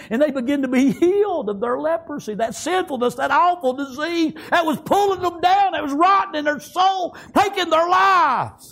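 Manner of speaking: high, sustained pulpit fervour; full-force preaching. Acted. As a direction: preach it in driving, high-pitched waves.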